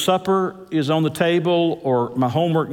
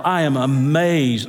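usual speaking voice

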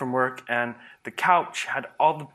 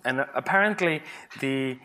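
ordinary delivery

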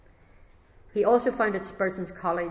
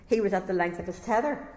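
usual speaking voice

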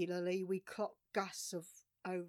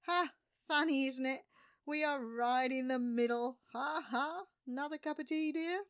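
higher-pitched, put-on chipper chat; mock-jolly banter; 6 semitones higher, 4 dB louder than usual. Sailor offering tea in the middle of bad forecasts, mock-jolly. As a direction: play it airy and jokey, pitched up.

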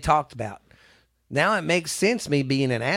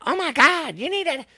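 much higher, gasping mom voice; shocked mom; 13 semitones higher than usual. Retold or imitated. Retelling the mom's phone call, high shocked squeal. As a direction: squeal it like an outraged mother. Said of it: "she's like".

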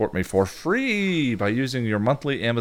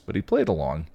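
ordinary delivery, nothing marked

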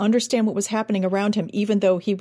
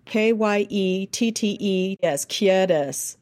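K Y E T T E S, letter by letter, slowly; spelled out slowly; much slower than usual. Shown as a demonstration. Coach spells a word for the actor, slowly. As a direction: spell the fake phonetics out slowly. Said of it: "use American letters like".

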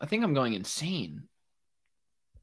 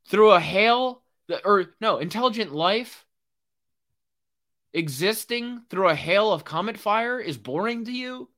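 louder, higher incredulous challenge; outraged disbelief; 6 semitones higher, 4 dB louder than usual. Real, not acted. Vlogger rebutting the article's writer, outraged and incredulous. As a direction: fire it back at the writer, outraged.

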